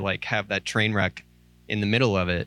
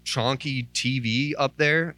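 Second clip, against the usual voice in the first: normal talking voice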